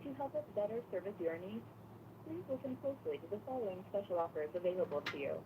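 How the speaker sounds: usual speaking voice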